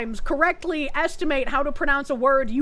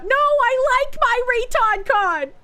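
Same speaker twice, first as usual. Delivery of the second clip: mock whiny protest; 10 semitones higher, 4 dB louder, slower than usual. Playful protest.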